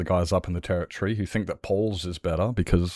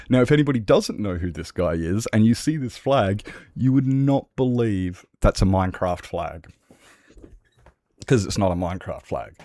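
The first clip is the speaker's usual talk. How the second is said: ordinary delivery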